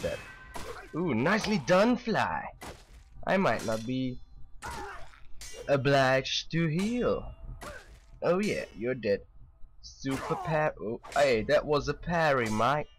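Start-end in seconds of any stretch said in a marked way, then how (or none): 5.7-7.3 drawn out. Real, not acted. Teasing the companion.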